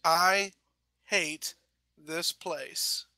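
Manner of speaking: halting words, trailing away; weakening, pause-broken last thought; fading consciousness. Acted. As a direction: drop off word by word with long pauses. Last thought before passing out.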